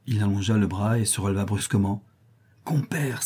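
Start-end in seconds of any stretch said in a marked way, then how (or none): 2.6-3.2 unvoiced whisper; a conspiratorial whisper to the partner. A narrator's reading. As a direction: breathe the word compère secretly. Hushed call before revealing gold.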